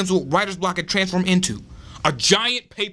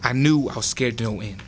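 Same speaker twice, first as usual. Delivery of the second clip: usual speaking voice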